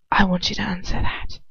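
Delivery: hushed unvoiced whisper. Heroine whispering to him in the dark. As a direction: breathe it, holding back. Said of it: "I whisper".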